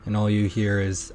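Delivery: usual speaking voice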